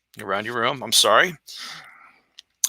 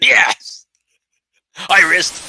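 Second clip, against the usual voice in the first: strained shout; 7 semitones higher, 5 dB louder, much slower than usual. Acted. Father yelling his confession.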